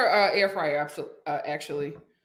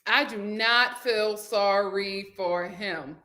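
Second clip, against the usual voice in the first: lower, slow and deliberate; slow emphasis; 4 semitones lower, 4 dB quieter, slower than usual. Real, not acted. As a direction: spell it out word by word. Restates it slowly for emphasis.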